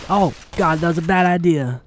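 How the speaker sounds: ordinary delivery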